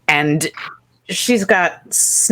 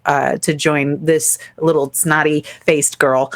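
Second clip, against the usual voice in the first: ordinary talk, nothing marked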